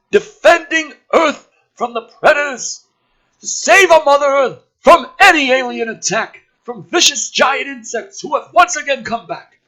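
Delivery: high, loud brash military man; gruff officer voice. Acted. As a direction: play the cocky colonel loud and high. [name] voiced, grandiose.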